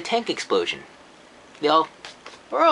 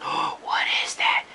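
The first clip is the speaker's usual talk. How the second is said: hushed unvoiced whisper. Kid finds the lid, awed whisper. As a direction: breathe it out in awe. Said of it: "he's like".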